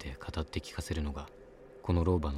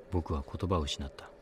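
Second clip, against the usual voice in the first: ordinary delivery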